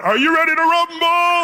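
high, loud ring announcer bellow; boxing ring announcer. Pretend. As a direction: boom it out like a fight announcer grabbing the mic. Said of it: "I was gonna grab it".

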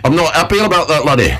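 put-on high puppet voice in a broad northern English accent; a cheeky puppet monkey with a northern English accent, challenging. Puppet monkey growling at its owner, northern English accent. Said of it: "northern accent".

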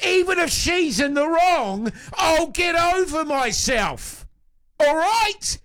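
high, half-laughing shouted tirade; mock-outraged rant. Real, not acted. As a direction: rant at the fellas in mock fury. Escalating rant about the sulking claim.

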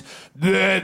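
mocking gibberish French. Montreal cop approaching a jaywalker, mock French babble. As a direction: babble in exaggerated fake French. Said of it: "speaking in French".

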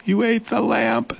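high, startled question; shocked mouse. Acted. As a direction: gasp it out as a dismayed mouse. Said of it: "gasped Mouse".

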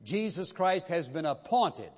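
ordinary delivery

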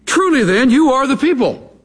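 high mocking sarcasm as Job; sarcastic. Job mocks his friends with a high sarcastic voice. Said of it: "having learned a little sarcasm".

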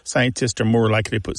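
normal talking voice

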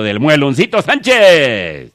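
loud, drawn-out announcer shout; rousing call-out. Pretend. Comedian sending a saludo to Mueloncito Sánchez, loud drawn-out call. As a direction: belt out the name, stretching it. Calling out a showy greeting.

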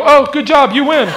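high, loud mock congratulation; mock cheering guys. Onlookers cheering after the knockout, high. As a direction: cheer sarcastically. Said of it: "the other guys gonna go".

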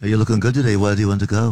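put-on Liverpool [name] accent. Shown as a demonstration. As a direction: fake a [name]'s Liverpudlian lilt. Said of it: "so I just did a [name] accent".

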